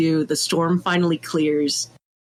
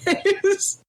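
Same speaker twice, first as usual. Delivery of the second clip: words squeezed out through a laugh; 12 semitones higher than usual. Real, not acted. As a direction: say it while still laughing. Laughing over the memory.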